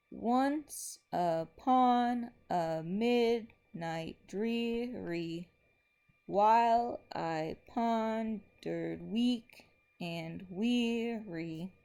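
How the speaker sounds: slow, each stressed syllable marked; slow metrical scansion, stresses spelled out; scanning meter. Shown as a demonstration. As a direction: tap out the beat of every syllable slowly. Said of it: "here's how we would mark this one".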